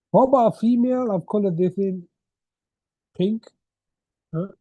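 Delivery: ordinary talk, nothing marked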